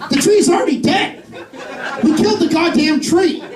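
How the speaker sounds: high-pitched comic exasperation; exasperated retort. Acted. Comic answering a tree-saving preacher, exasperated and high.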